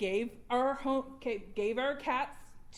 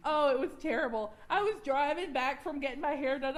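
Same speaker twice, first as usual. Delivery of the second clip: usual speaking voice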